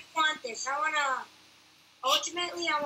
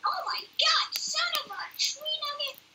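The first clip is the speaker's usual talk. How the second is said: squeaky outraged voice; 12 semitones higher than usual. Acted. Mock outrage at gift.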